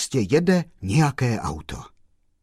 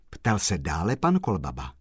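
ordinary delivery, nothing marked

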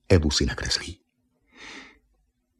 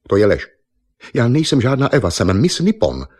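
louder, indignant denial; změněný tón, odmítnutí; 5 semitones higher, 5 dB louder than usual. A narrator's reading. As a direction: snap into a higher, forceful protest. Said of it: "Náhle změnila tón". (náhlý rozhořčený protest)

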